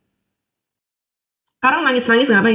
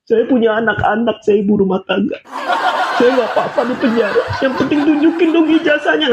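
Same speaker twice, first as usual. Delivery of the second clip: mocking whiny quote; 2 semitones higher than usual. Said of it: "Gak usah kamu sekarang nyebut-nyebut".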